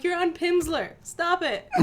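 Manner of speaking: normal talking voice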